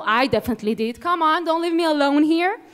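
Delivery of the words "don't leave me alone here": ordinary delivery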